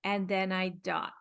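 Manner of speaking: ordinary talk, nothing marked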